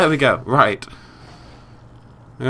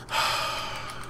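long, soft exhale to calm down; slow calming sigh; 10 dB quieter than usual. Real, not acted. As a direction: let the frustration out in a breath. Calming down after outburst.

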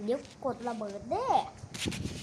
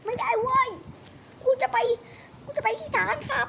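high yelled refusal; 11 semitones higher than usual. Acted. Refusing to hand over the fuel, high indignant yell. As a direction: shout it high and stubborn.

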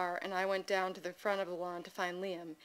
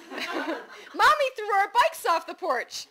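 laughing tattle; 12 semitones higher, 8 dB louder, much slower than usual. Real, not acted. Little boy telling on Mommy, laughing. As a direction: squeal it out while laughing.

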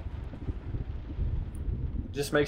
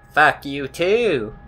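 slow, stretched-out comeback; mock-petty, drawn-out retort; 2 semitones higher, much slower than usual. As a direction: drag the words out, last one falling. Retort, repeated in silly voice.

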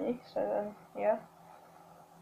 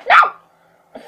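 sudden high scream; 9 dB louder than usual. Real, not acted. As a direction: shriek it out at full force. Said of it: "that hurt my throat so much".

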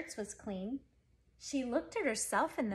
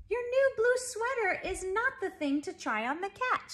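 high, loud scolding mother voice; a mother's raised, exasperated scold; 10 semitones higher, 5 dB louder than usual. A narrator's reading. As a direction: voice the shocked mother telling her off. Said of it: "she said".

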